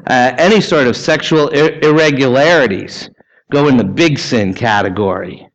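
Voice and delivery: ordinary delivery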